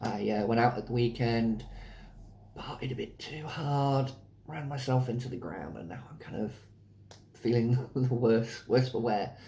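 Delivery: hoarse, sore throat voice; speaking through a sore throat. Real, not acted. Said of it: "I have a hell of a sore throat coming on".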